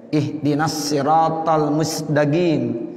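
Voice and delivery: slow Egyptian recitation, "mustaqim" as musdagim; Egyptian dialect. Shown as a demonstration. Teacher imitating Egyptian recitation, dialect g in "mustaqim". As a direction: Egyptian hard g for the qaf in "mustaqim". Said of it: "kalau qaf pakai ga".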